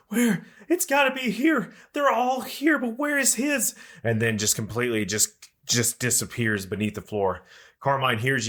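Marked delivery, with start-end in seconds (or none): 0.1-3.7 very high, far-off searching wail; a lost spirit calling through the walls; ghost voice. Acted. Ghost wailing as it searches the room, very high. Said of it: "you hear the ghost go".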